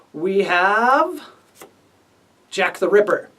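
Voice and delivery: normal talking voice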